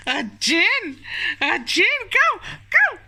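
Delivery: high, loud squealing; excited cheering. Real, not acted. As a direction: cheer on the little one.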